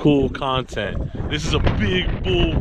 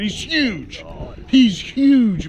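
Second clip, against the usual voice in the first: excited; 8 semitones higher, much slower than usual. Real, not acted. Seeing the huge fish.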